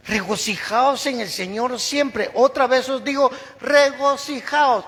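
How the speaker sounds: loud, high, slow preaching of the verse; preaching at full force. Acted. Preacher urging the congregation to rejoice, loud and slow. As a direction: proclaim it to the whole church.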